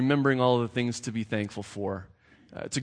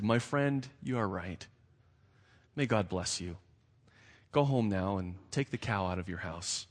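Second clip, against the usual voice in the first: soft, lower, tender reply; sweet and gentle; 4 semitones lower, 6 dB quieter than usual. A narrator's reading. Rabbi gently answering the wailing poor man. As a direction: speak kindly and softly to him. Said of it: "The rabbi listened sweetly".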